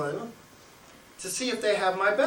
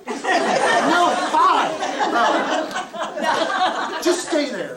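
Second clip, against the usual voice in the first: high, loud, laughing mock insistence; laughing retold protest; 9 semitones higher, 6 dB louder, much slower than usual. Retelling the exchange with Joanne, laughing mock protest. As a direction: act out the order through helpless laughter.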